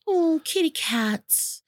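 high, slow cooing. Real, not acted. Cooing about cats.

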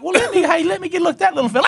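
high, fast, sugary coaxing; coaxing adult. Retold or imitated. Grandpa pretending to admire the boy's toy, high and fast. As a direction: sweet-talk the toddler quickly. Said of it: "You're like".

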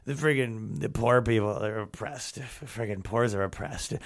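put-on low, soft drunken mumbling; lightly voiced drunk muttering to himself. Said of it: "he's muttering to himself".

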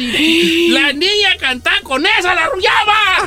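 high, mounting exclamation; mock-outraged high shout; incredulous outcry. Real, not acted. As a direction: exclaim it in scandalised disbelief, rising.

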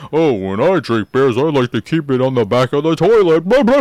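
mock her with a dumb put-on voice; goofy parody that rises into loud blabber; stupid voice. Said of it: "in a stupid voice".

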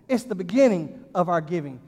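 ordinary talk, nothing marked

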